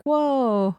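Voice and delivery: high, drawn-out gasp of amazement; amazed whoa. Retold or imitated. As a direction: react like it blew your mind. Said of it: "we were like".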